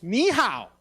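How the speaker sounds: high, loud cashier's greeting; abrupt loud greeting. Retold or imitated. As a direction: imitate a cashier calling out a foreign hello.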